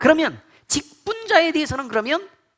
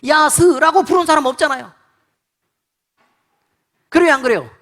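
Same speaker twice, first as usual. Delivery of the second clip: loud rhetorical jab; 4 semitones higher, 7 dB louder than usual. Real, not acted. Rhetorical challenge to congregation.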